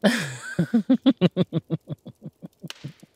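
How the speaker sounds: loud, high burst of laughter trailing away; long hearty laugh; big laugh. Acted. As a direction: crack up, then let it fade. Cracks up at partner's tease.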